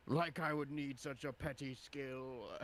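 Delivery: high, haughty character voice; scornful boast. Acted. Death giant answering the warning about teleporting, scornful boast.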